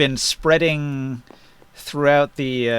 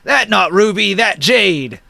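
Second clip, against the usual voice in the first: higher-pitched bickering giant; 6 semitones higher, 3 dB louder, slower than usual. Acted. Giant correcting another over treasure, raised-pitch giant voice. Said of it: "And the other one says".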